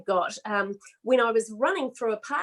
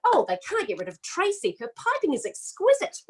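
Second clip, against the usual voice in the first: lightly voiced old lady gushing over baking; a hint of a prim older woman fussing over piping; old lady judge; 6 semitones higher than usual. Son imitating a sixty-five-year-old woman, lightly voiced. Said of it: "turn into a sixty-five-year-old woman".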